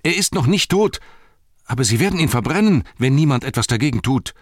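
high, loud, urgent protest; loud frantic pleading; desperate cry. A narrator's reading. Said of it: "schrie Pippin".

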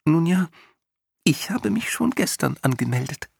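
high, small chirpy voice; a tiny being, matter-of-fact; tiny creature voice. A narrator's reading. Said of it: "Der Winzling blickte ihn nachdenklich an".

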